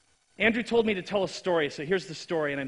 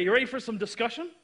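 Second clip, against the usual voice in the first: usual speaking voice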